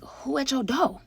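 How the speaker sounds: high, slow feigned innocence; acting clueless to the girlfriend; mock innocent question. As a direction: play dumb slowly and high. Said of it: "I'm like".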